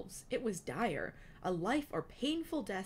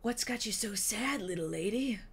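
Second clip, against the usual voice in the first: man's voice; 4 semitones higher, 3 dB louder than usual. A narrator's reading. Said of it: "Wingtip asked".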